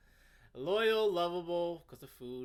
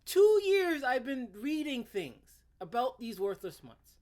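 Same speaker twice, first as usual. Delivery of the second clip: mock outrage; 7 semitones higher, 7 dB louder than usual. Continues mocking the writer.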